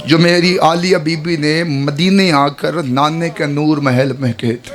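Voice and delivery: slow, mournful preaching voice; majlis recitation. Acted. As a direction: recite the lament slowly to the mourners. Majlis recitation of Zainab's lament.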